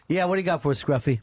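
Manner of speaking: ordinary delivery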